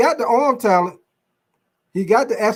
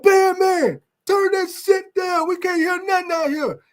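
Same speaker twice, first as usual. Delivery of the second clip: high, slow mock holler; imitating a coach yelling at the band; 9 semitones higher than usual. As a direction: bellow at the band like a fed-up coach. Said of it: "our coach be like".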